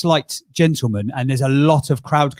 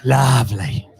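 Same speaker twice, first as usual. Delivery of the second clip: mocking Ashley; 3 semitones lower, slower than usual. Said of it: "mocks Ashley's quote".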